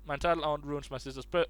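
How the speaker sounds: ordinary talk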